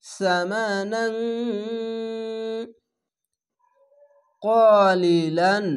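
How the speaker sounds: slow, drawn-out melodic chant; Quran recitation. Shown as a demonstration. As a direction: intone it like tajweed recitation. Tajweed recitation of Quran words. (ধীর সুরেলা কুরআন তিলাওয়াত)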